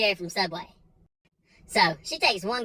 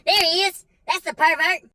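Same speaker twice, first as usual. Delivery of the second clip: much higher and louder, pointing him out; mom calling out; 8 semitones higher, 5 dB louder than usual. Retold or imitated. Mother calling the guard over from a distance, loud and high. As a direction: shout him out across the store. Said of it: "I hear off in the distance".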